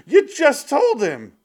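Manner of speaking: very high, loud, slow mock outrage; exasperated retort. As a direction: shout it in disbelief. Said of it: "I'm like".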